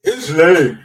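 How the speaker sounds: mocking echo. Mocking co-host's excuse.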